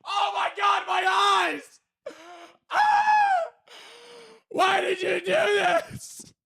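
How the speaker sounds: high wailing cry from backstage; distant anguished cry. Retold or imitated. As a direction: wail like someone in agony. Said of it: "really faint behind the curtain".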